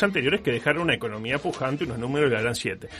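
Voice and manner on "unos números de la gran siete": ordinary talk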